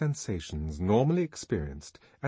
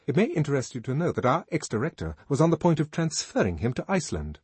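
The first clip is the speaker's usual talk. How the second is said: higher, fast, louder confiding voice; Henry Foster; 6 semitones higher, 4 dB louder, faster than usual. A narrator's reading. Henry Foster telling the songster gossip, brisk confiding. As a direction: rush the juicy tidbit. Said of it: "said Henry Foster".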